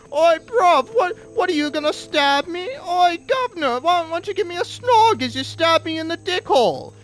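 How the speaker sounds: high, mocking British geezer accent; hammed-up cockney British lad. Mocking UK people for stabbing, in a high hammed-up British accent. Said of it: "make fun of, like, the U K for being like".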